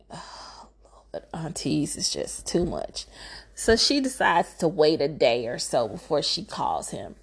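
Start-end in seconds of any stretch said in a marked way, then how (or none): 0.1-3.1 unvoiced whispered aside; hushed whisper, half to yourself. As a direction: breathe it out like a secret eye-roll. Aside about pushy aunties.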